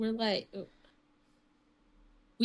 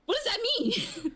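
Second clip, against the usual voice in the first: high, laughing question; laughing confusion; 12 semitones higher than usual. Retold or imitated. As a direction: ask it while cracking up. Confused coworkers asking.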